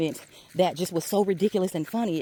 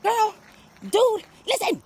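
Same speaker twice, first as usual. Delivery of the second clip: high, louder shout; inner self yelling; 4 dB louder, slower than usual. Pretend. Inner self yelling to be heard, high and loud. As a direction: shout high to break through the noise. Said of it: "They're screaming at you".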